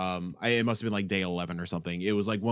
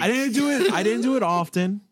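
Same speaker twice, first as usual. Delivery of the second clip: high, loud laughing denial; defensive protest; 8 dB louder than usual. Real, not acted. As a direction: protest your innocence, half laughing. Accused of scamming reservations, protesting.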